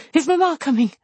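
high-pitched, frantic question; feverish wild cry. A narrator's reading. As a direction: cry out as if waking in fevered alarm. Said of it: "with feverish wildness cried out".

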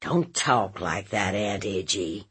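low, subdued protest; embarrassed nephew. Acted. Nephew turned red begging aunt to stop, embarrassed.

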